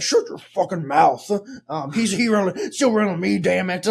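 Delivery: Hagrid telling Hermione off; a gruff hurt giant yelling back; angry Hagrid. Pretend. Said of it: "And Hagrid's like".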